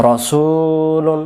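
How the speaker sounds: রসুলুন with "su" sustained slowly on a level note; slow, recited "ro-suuu-lun"; long middle vowel. Shown as a demonstration. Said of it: "এলোমেলো আমরা পড়বো না".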